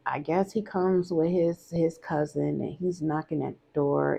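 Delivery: normal talking voice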